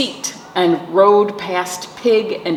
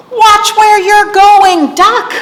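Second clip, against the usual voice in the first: high, loud, slow scolding hen; cross chicken; 8 dB louder, slower than usual. A narrator's reading. Chicken scolding Duck, high, loud and slow.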